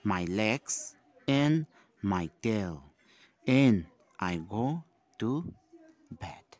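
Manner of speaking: slow, loud classroom recitation; measured poem read-aloud for small children. Shown as a demonstration. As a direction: read the verse out slowly and loudly, pausing between phrases. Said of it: "Again, I will read out the poem".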